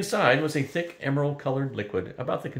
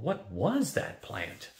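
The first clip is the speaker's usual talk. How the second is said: slow, softer, appalled question; 3 semitones higher, 5 dB quieter, much slower than usual. A narrator's reading. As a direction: draw it out in hushed dismay.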